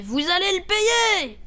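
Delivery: drawn-out, high-pitched yell; howled threat. A narrator's reading. Priest chasing Gilbert out of the house, howling. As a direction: howl it slowly, pitch soaring. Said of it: "le curé qui hurlait".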